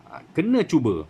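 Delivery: ordinary delivery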